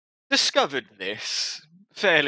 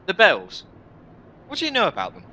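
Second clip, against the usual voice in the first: higher, louder questioning; urgent questioner; 9 semitones higher, 6 dB louder, faster than usual. Acted. Newcomer asks the warrior about the bells, urgent questioning. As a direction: blurt the question out at a higher pitch. Player character blurts out a question.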